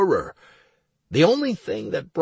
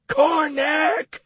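calling out; 4 dB louder than usual. Acted. Calling out to teacher.

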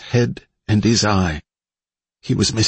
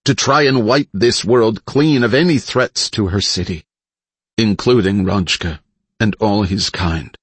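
usual speaking voice

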